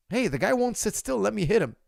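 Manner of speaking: high whiny mock complaint. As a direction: whine like a naive fighter. Mocking clueless fighter.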